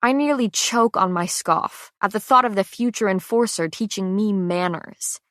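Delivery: plain reading voice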